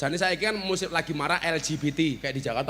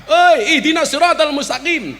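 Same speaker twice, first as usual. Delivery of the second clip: higher, louder mock call with a laugh; 9 semitones higher, 10 dB louder than usual. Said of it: "Karena orang padi".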